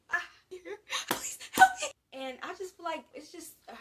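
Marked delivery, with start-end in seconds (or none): 0.1-1.9 high, loud victim's cry for help; hammed-up terrified yelp; mock horror plea. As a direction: play a panicked horror-movie victim. Mock horror movie victim trapped.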